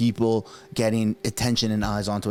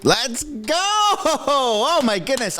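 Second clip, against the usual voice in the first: much higher, drawn-out delighted cry; thrilled cheer; 12 semitones higher, much slower than usual. Real, not acted. Vlogger cheering the Bloc's election threat, thrilled. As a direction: celebrate it like a goal was scored.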